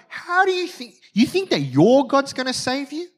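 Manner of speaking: high-pitched incredulous taunt; jeering disbelief, pitched well up; mocking. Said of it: "The mocking continues".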